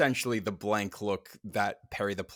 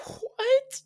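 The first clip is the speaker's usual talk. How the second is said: high, loud, drawn-out bewilderment; baffled "what"; 6 dB louder than usual. Acted. Host gazing at the infinite universe, baffled high "what". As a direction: squeak it out in cosmic confusion. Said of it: "and we just go".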